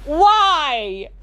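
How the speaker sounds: ordinary talk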